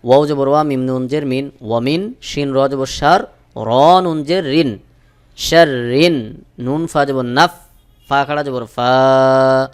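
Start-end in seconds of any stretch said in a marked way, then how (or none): 5.4-6.4 very slow chanted recitation with ghunnah; slow Quran recitation. Shown as a demonstration. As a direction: draw out each word in tajweed. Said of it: "অবশ্যই গুন্নাহ". (ধীরে তাজবীদসহ তিলাওয়াত)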